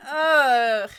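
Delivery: big exasperated groan; 1 loud disgusted groan. Acted.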